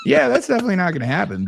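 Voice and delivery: quick, high, laughing brush-off; amused dismissal. Real, not acted. Coach brushing off a joke about being head-coached, amused dismissal.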